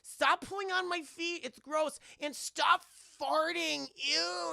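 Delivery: put-on high, whiny little-girl voice; mock little girl complaining about her feet. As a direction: whine like a grossed-out kid. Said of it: "she's like".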